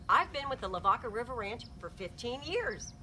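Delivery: ordinary delivery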